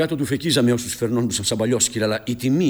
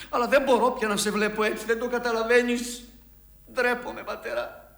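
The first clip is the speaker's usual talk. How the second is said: high, strained admission of shame, broken by pauses; anguished confession; 9 semitones higher than usual. Acted. Son telling his father he is ashamed, anguished confession.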